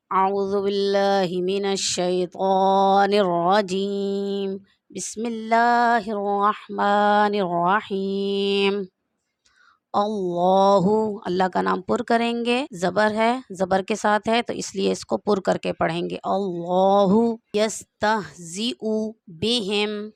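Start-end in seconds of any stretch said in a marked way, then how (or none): 0.1-11.2 slow, drawn-out tajweed recitation; Quran recitation. Shown as a demonstration. Opens lesson reciting Quran slowly. 16.3-20.1 slow, measured recitation of the verse. Shown as a demonstration. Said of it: "यहाँ पर ये लफ्ज़ बड़ा भी है".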